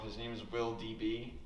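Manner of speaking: ordinary talk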